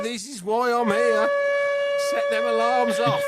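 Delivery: high, loud celebratory shout; jubilant shouting. Real, not acted. Host celebrates caller's fourth-place prediction, jubilant shouting. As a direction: shout it out with delight, stretching key words.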